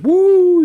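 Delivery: loud high party whoop. Retold or imitated. Riders leaving the bar ready for powder, imitated loud whoop. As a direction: let out a long, high whoo.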